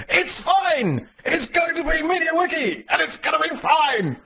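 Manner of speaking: very high, breezy mock voice; mock reassurance. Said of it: "And Bozidir went".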